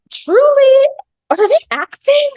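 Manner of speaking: high, loud incredulous wail; incredulous cry. Real, not acted. Recapping the film to viewers, crying out in disbelief.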